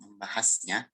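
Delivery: ordinary delivery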